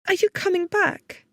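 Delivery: put-on high, plaintive young woman; anxious young assistant. A narrator's reading. Young woman asking if boss returns, plaintive.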